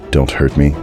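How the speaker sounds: usual speaking voice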